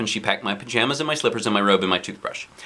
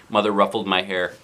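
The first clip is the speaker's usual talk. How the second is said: ordinary delivery, nothing marked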